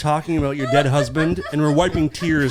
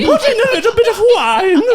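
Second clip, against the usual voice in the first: very high, loud fluttery cook voice; Julia Child; 8 dB louder than usual. Pretend. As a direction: ham up a TV chef. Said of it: "I'm Julia Childs".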